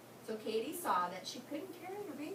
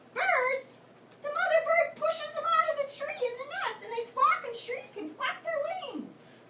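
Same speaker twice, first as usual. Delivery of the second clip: much higher and louder, aghast; high shocked mother; 11 semitones higher, 7 dB louder than usual. Acted. Katie to Freddie, high appalled objection. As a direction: squeal it, appalled, slowing at the end.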